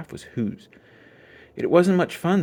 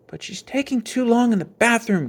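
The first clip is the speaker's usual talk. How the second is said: complaining; 12 semitones higher than usual. A narrator's reading. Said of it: "complained brother".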